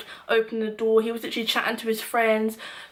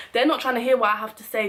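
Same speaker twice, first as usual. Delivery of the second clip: ordinary talk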